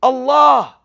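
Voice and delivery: loud, high cry of Allah; imitating a friend crying out mid-crash; retold scream. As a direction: cry out Allah in sudden panic. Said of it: "he screams".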